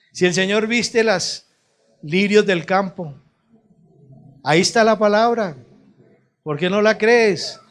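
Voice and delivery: full-voiced sermon build; fiery preaching. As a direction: preach it out over the congregation.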